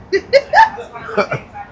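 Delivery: high, bright burst of laughter; real amused laugh. Real, not acted. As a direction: cackle at your own joke. Laughing at own remark.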